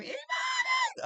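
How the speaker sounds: high, loud joyful yell; shout. Retold or imitated. Overjoyed child yelling to the crowd at the park. Calling out joyfully at seeing mother.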